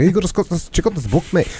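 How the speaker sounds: a hint of a Scottish buddy, not a full accent; imitating Colin. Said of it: "I won't try and do a Scottish accent".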